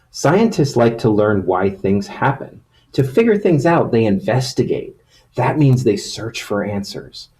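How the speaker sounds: storybook reading voice; reading to children. Acted. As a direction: read it like a picture book to kids. Reads picture book to children.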